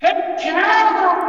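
yelled high from away from the mic; far from mic. Shown as a demonstration. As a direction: shout it from across the room, far off. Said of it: "step away from the mic and yell".

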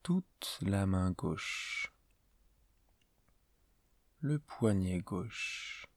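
slow, spaced-out meditation voice with long pauses; guided meditation. Acted. Teacher leading a listener into yoga nidra before sleep, calm guided meditation. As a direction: guide the listener gently through a body scan, unhurried.

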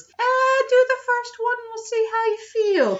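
strained, very high-pitched character voice; Dragon's voice. Retold or imitated. Dragon putting off Hemlock until after the first job, strained high character voice. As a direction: put on a high, eerie villain voice. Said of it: "Dragon kinda pulls this".

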